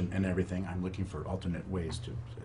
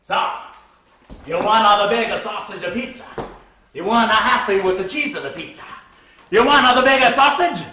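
much higher and louder, bellowed; furious shout; 13 semitones higher, 16 dB louder than usual. Acted. Pizza boy finally yelling back at the rude customer, full force. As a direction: blow up at her full force.

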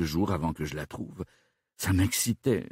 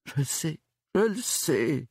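higher-pitched, tearful stammer; on the verge of tears; 8 semitones higher than usual. A narrator's reading. Suspect to detective about his missing daughter, on the verge of tears. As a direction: stammer it high, close to crying. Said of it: "balbutia Gilbert au bord des larmes".

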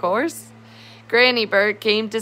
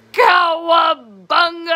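high, slow, drawn-out shout of joy; excited cry; 9 semitones higher, 5 dB louder, slower than usual. Acted. Cookie Monster cries out at the cookies, high and loud. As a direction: belt it out like a thrilled monster. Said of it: "cried Cookie".

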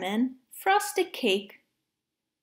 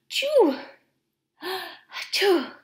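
gasp, then a higher-pitched achoo; acted sneezes; 5 semitones higher, slower than usual. Monkeys sneezing while sifting flour, acted sneezes. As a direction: play-act two sneezes with a sharp intake.

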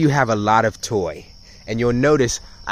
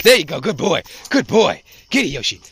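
high-pitched praise for a dog; real, hearty dog praise; encouraging praise; 6 semitones higher than usual. Real, not acted. Praising dog during tug.

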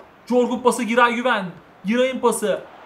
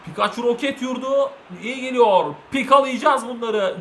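ordinary delivery

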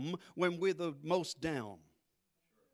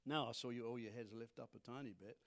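usual speaking voice

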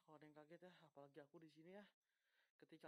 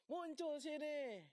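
taunting shout; 10 semitones higher, 14 dB louder, much slower than usual. Real, not acted. Taunting the ghost in horror game.

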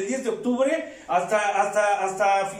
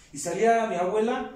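ordinary talk, nothing marked

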